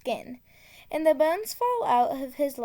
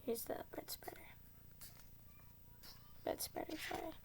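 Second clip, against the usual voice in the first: unvoiced whisper, very soft; hushed secret whisper; 3 semitones higher, 22 dB quieter, much faster than usual. Shown as a demonstration.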